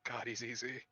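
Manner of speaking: low, hushed murmur; whispered aside. Acted. Lily sidles up and whispers in Lake's ear, hushed. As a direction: murmur it softly, close to the ear. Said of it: "just whispers in their ear".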